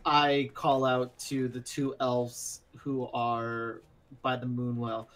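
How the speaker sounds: normal talking voice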